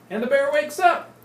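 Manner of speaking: high, loud big reveal; dramatic reveal. A narrator's reading. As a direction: land the wake-up like a surprise. Dramatic reveal of waking bear.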